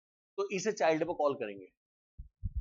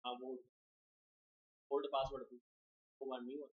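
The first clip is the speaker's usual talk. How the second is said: much softer, lower side conversation; quiet phone aside; 7 semitones lower, 13 dB quieter than usual. Real, not acted. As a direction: talk low into the phone, away from the class. Still on the phone call.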